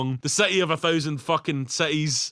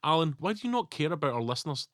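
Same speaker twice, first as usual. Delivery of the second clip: ordinary talk, nothing marked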